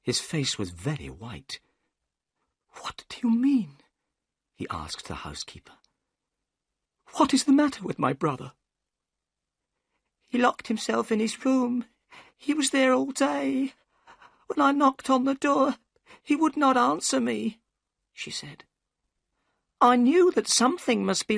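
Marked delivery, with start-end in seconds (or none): 2.8-3.8 high, breathless whisper; shocked whisper. A narrator's reading. Thaddeus shocked by news. 7.2-8.5 fast, high and urgent; anxious Thaddeus. A narrator's reading. 10.3-17.6 put-on very high, trembling old woman's voice; a scared old servant pleading; frightened housekeeper. A narrator's reading.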